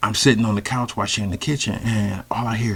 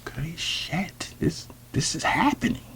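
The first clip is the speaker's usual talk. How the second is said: stunned whisper; 6 semitones higher, 9 dB quieter than usual. Retold or imitated. Man realizing his date is undressing, stunned whisper.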